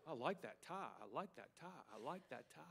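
very soft, low imitated admirers; murmured compliments. Preacher mimics people admiring his tie, quiet compliment. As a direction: toss it off quietly, like passing praise.

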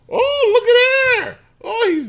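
slow, very high falsetto marveling; mock amazement. Spotting that he already has an account, mock amazement. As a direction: gush in a squeaky-high pretend surprise.

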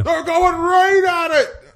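high, loud, slow announcer call; wrestling commentator calling the match; hyped commentator. Acted. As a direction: call the action like a ringside commentator.

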